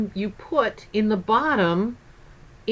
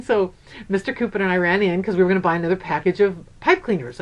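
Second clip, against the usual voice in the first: normal talking voice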